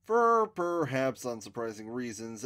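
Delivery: ordinary delivery, nothing marked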